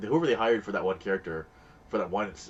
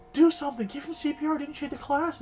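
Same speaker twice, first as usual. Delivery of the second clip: much higher and louder, panicked plea; frantic mother; 4 dB louder than usual. Retold or imitated. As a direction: beg someone to save him, loud and high. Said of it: "she's like".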